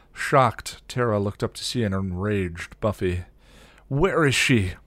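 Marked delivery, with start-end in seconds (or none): 3.9-4.7 raised, loud angry question; high, insistent demand. A narrator's reading. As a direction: demand it forcefully. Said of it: "Buffy demanded".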